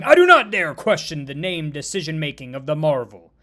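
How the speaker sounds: loud, emphatic devotion to a hero; loud starstruck protest; indignant loyalty. Acted. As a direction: burst out as if questioning her were unthinkable. Starstruck recruit protests on hero's behalf.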